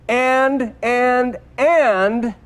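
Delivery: "And" repeated high, each one set apart by pauses; stress on And. Shown as a demonstration. Preacher making listeners notice the joining word, "and" stressed high. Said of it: "Notice that".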